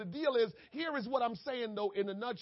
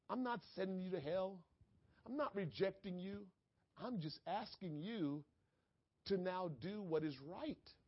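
God speaking softly; 7 dB quieter than usual. Acted. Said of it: "God is saying".

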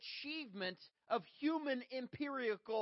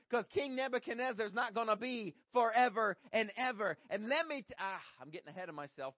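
ordinary talk

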